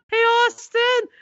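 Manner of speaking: put-on high, drawn-out child's call; Ryan. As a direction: imitate a little boy calling through the door. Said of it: "then he comes back".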